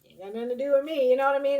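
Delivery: loud, high, fast brush-off; acted dismissal. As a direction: belt it out as a quick disclaimer of involvement. Voices Leo staying out of drama.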